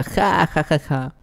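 scornful ja ja that jumps high, then dies away; a bandit mocking the hero about his ex; sneering laugh; taunting laugh. A narrator's reading. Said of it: "Él le responde burlándose".

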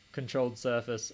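ordinary talk, nothing marked

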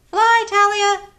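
put-on high bright call to the child; high encouraging call; high cheer. Shown as a demonstration. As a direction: lift the voice up to cheer her on.

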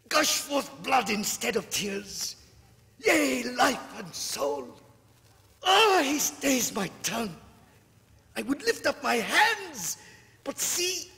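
high, loud panicked cries; terrified. Acted. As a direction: shriek in terror at the devils. Terror as devils seize him.

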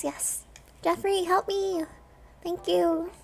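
ordinary talk

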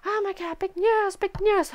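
high-pitched giddy imitation; giddy mock exclaim. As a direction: squeal it like a starstruck reporter. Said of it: "He's like".

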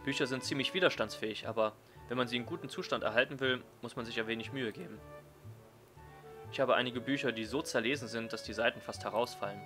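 ordinary talk, nothing marked

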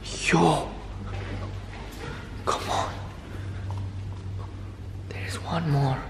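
very soft, voiceless whisper; hushed whisper. Acted. Vlogger sneaking to the door for viewers, nervous whisper. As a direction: whisper as if afraid of being heard. Picks up balloons outside.

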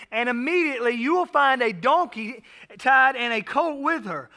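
measured, lifted reading of the Gospel; scripture reading. Acted. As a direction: read the passage to the congregation. Reads Matthew aloud.

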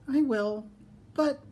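high-pitched piglet, hesitating; piglet giving in. A narrator's reading. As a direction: agree in a high small-pig voice, then stall. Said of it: "sighed the piglet".

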